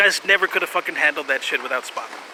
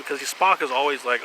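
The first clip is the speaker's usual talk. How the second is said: normal talking voice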